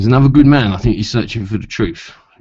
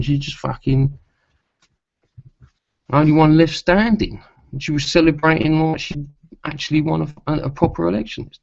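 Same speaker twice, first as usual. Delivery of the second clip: exasperated rant; 8 semitones higher than usual. Real, not acted. Ranting about Theresa May celebrating.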